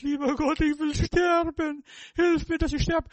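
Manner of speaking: a man in a cave pleading with God; Elijah begging to die. Retold or imitated. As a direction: beg God to let you die.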